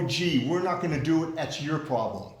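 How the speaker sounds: ordinary talk, nothing marked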